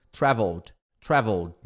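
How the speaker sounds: traveled said "trévold", ending in d; pronunciation demo. Shown as a demonstration. Teacher modelling the ending for students, traveled ending in d. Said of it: "som de D".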